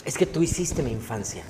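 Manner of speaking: hushed, low retold fan confession; imitated fan speaking low and quietly; imitating fans. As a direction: voice a fan, soft and low, after a pause. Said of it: "llegan los fans y me dicen".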